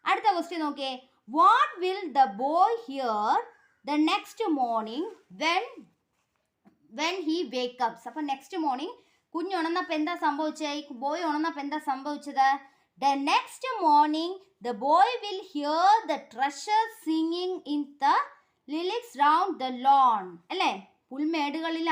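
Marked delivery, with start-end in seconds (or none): 1.4-8.0 very slow, word by word for pupils; teacher dictating to a class; slow dictation. Shown as a demonstration. Teacher reads question slowly. 13.0-20.3 very slow, word by word for pupils; teacher dictating to a class; slow dictation. Shown as a demonstration. Teacher reads answer slowly.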